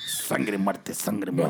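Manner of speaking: low, growled devil chant; little devil. Acted. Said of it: "Yo quiero ser el diablito". (diablito con voz grave)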